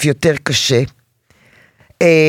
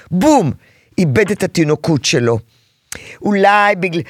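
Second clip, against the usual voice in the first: ordinary delivery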